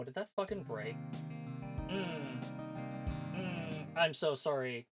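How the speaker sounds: ordinary talk, nothing marked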